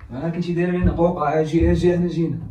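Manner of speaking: loud, urgent summons; calling out. Acted. Older brother calls his sister to come, loud urgent call. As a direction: call her over with force.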